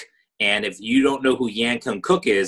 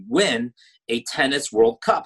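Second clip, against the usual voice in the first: normal talking voice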